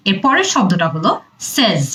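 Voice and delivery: ordinary talk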